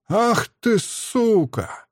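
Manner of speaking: high-pitched, drawn-out curse; angry cry. A narrator's reading. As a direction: spit out the insult in a high, furious cry. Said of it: "кричу я". (злобный высокий выкрик)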